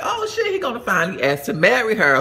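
like you just guessed the big secret; high gossipy "oh shit" excitement; excited thought. Retold or imitated. Thinking he will propose.

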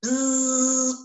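loud mock bee buzz; playful buzzing sound for the kids. A narrator's reading. Imitates the beast buzzing.

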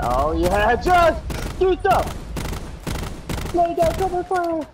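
loud, high, drawn-out shouting; excited yell. Real, not acted. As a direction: bark orders across the battlefield. Yelling orders in firefight.

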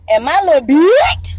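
"dick" blurted loud and high; over-emphasized, loud "dick" at the end; heavy emphasis. Real, not acted. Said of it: "I be putting so much emphasis on that".

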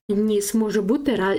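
ordinary delivery

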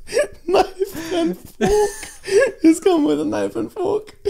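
high, strained words through laughter; laughing hard. Real, not acted. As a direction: squeeze it out while cracking up. Laughing fit at the image.

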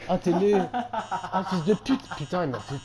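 loud laughing curses after a scare; laughing shouts. Real, not acted.

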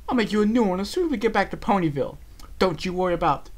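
high, refined reassuring voice; Rarity reassuring. A narrator's reading. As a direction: promise kindly.

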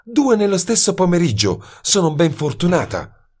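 high, sharp female voice, flung out in anger; bitter outburst from a cornered woman, pitched high; violent exclamation. A narrator's reading. Miss Mayfield exclaims at the unwanted detective, violent and high. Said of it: "esclamò con violenza".

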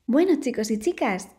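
ordinary delivery, nothing marked

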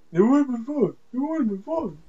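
high, whiny mimicry of a friend; mocking imitation. Player mocking friend's complaint, mocking imitation. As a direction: mimic him in a silly high voice. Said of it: "Sorry, I was mocking him".

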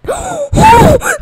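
loud gasp of relief; sharp high gasp. Real, not acted. Relief at secret vote.

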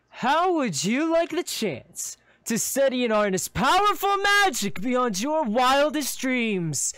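high, loud, sweeping showman's pitch; grand cloaked figure. Acted. Cloaked figure tempting you with magic, grandiose. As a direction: proclaim it grandly, stretching the big words. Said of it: "raises his arms grandly".

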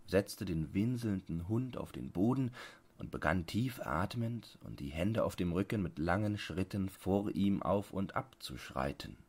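usual speaking voice

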